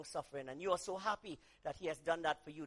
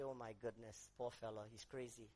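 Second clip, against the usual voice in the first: softened pity; 10 semitones lower, 11 dB quieter than usual. Retold or imitated. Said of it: "I'd say".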